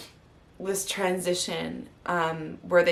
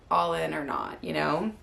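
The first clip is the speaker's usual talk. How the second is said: ordinary delivery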